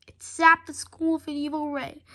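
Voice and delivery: ordinary delivery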